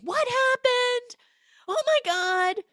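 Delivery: high, loud, slow mock scream. Mother running inside at the wrecked car, mock scream. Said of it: "She runs inside screaming".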